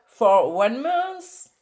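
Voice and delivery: slow English with a French accent; careful, drawn-out French-accented English. Real, not acted. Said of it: "mon accent est toujours nul".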